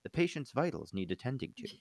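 soft, calm automated announcement; calm robot voice. Acted. Hospital monitor alerting doctors, calm robot voice. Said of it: "a calm robot voice going".

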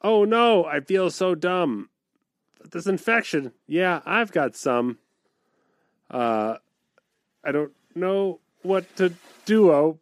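sing-song limerick recital; bouncy limerick lilt. Pretend. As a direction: bounce through the rhyme. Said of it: "This is for Creamer Jean".

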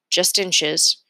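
plain reading voice

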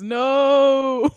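loud, high, drawn-out "no"; hammed-up movie scream, high and loud; mock scream. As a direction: stretch it into a mock-anguished cry. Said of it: "he screams".

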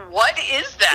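imitated bewildered husband; mock incredulous reaction. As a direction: retell a baffled question through laughter. Husband's reaction to old dog photo.

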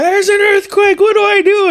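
imitating a terrified cousin shrieking; panicked scream. Cousin Dave at the front door mid-earthquake, frantic high shriek. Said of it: "he's screaming".

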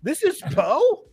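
high, drawn-out incredulous question; mock disbelief. Host recalls hearing an actor's real accent, incredulous. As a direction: stretch it upward in retold shock.

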